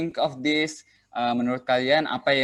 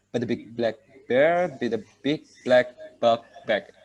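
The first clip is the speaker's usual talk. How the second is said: slow, careful tongue twister with every word spaced out. Shown as a demonstration. Teacher demonstrating the tongue twister to students, slow and deliberate. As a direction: take it slowly so the class can follow. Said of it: "you can start by slowly".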